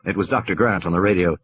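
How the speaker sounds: ordinary delivery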